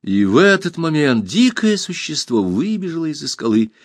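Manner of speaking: usual speaking voice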